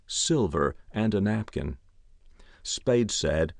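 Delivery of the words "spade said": plain reading voice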